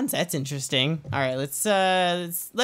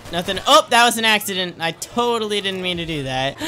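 ordinary talk

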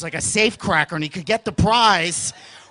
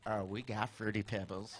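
put-on very soft conspiratorial kid voice; sneaky whisper; 12 semitones lower, 18 dB quieter than usual. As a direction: whisper the secret knowingly.

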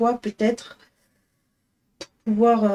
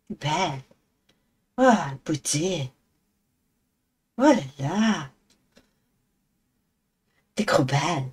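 low cooing pet voice; 4 semitones lower than usual. Real, not acted. Owner admiring her cat in a low croon. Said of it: "des voix débiles quand on parle à nos animaux".

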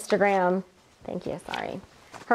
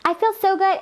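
mock bubbly contestant; 8 semitones higher than usual. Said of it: "she's like".

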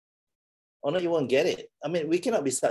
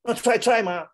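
mock whine; 8 semitones higher, 3 dB louder than usual. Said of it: "mimicking students saying try".

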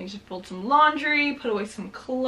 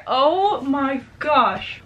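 slow relieved sigh; 4 semitones higher, much slower than usual. Real, not acted. Relief after bee.

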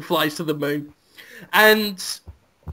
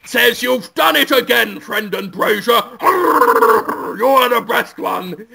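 higher, slow, blustery Gungan judge; Boss Nass voice; 4 semitones higher, slower than usual. Acted. Judge Boss Nass praises Brendan Fraser, booming and higher. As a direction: boom it out like a wobbly alien judge. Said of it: "It is Boss Nass".

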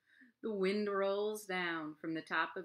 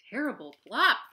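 fox in fear; 4 semitones higher, 10 dB louder than usual. Acted. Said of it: "thinks the fox in fear".

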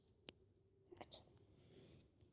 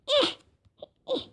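ordinary talk

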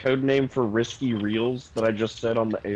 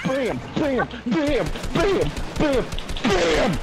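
high, hyped bam bam bam; excited bam; 10 semitones higher, slower than usual. Real, not acted. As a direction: hammer the sound with each shot. Shooting in game.

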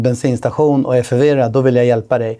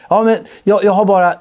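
higher, rushed mock annoyance; exasperated refusal; 5 semitones higher than usual. Customer brushing off a salesman during the match, mock exasperated. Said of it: "customer refuses".

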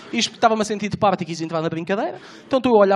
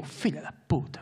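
muttered swear; 4 semitones lower, 10 dB quieter, slower than usual. Retold or imitated. Amazed mutter at dancer.

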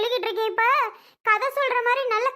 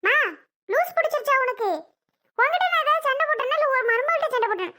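higher, quickening indignant comeback; indignant retort; 4 semitones higher, faster than usual. Acted. Daughter snapping back at her mother, indignant higher retort. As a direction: throw the accusation back at her, rising.

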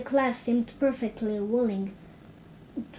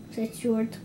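usual speaking voice

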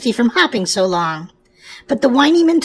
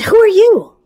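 a sharp, higher startled ask; startled question; 9 semitones higher, 4 dB louder than usual. Acted. Frightened rabbit asks the stranger, startled and louder. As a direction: blurt it out, alarmed.

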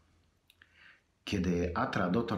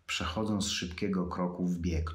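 ordinary delivery

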